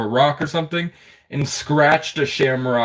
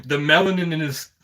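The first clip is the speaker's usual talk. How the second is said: normal talking voice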